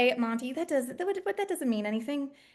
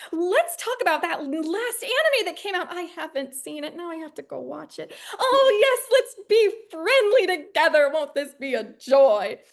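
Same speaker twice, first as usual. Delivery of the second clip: much higher and louder, laughing; hammed-up forced cheer; 3 semitones higher, 5 dB louder than usual. Indie crew forcing friendliness, hammed-up cheer. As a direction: overdo the friendly team spirit. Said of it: "everything is like".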